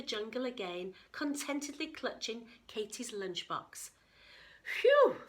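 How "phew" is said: high loud sigh of relief; relieved phew. A narrator's reading. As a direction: blow out the relief. Katie relieved after escape.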